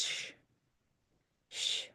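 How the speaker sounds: a soft "sh", S pulled back behind the teeth; soft sh sound. Shown as a demonstration. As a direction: hush out "sh" softly, tongue drawn back. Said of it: "S followed by a bright vowel ... becomes sh".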